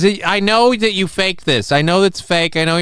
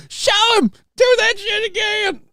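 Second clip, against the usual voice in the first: imitated excited yell. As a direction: mimic an overexcited storyteller egging someone on. Mimics the clip storyteller's yell.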